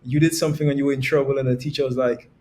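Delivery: usual speaking voice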